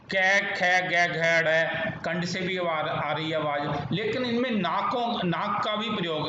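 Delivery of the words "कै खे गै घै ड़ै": कै, खे, गै, घै said loud and isolated; throat sounds demo. Shown as a demonstration. Teacher showing the throat consonants. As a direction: pop each throat sound out. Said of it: "ये देखो ना".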